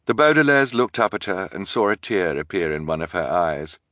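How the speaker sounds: ordinary delivery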